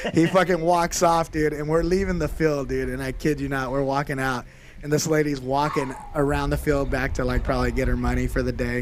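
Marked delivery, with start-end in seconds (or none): none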